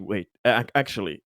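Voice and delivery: normal talking voice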